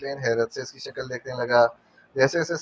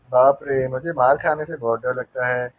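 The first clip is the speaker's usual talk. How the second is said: plain reading voice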